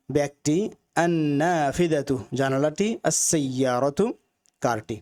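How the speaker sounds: normal talking voice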